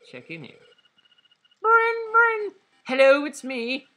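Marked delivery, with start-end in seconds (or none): 1.6-3.9 very high, loud cartoon caller; high phone character voice. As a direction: chirp a cheery phone greeting.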